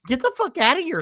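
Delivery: high-pitched mock yell; hammed-up indignation; pretend scolding. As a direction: shoo off a pest, voice jumping up. Yelling at squirrel, acted.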